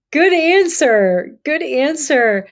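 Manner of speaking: high, drawn-out exclamation of approval; emphatic approval. Retold or imitated. Traveler retelling her reply to the pilgrimage leader, emphatic approval.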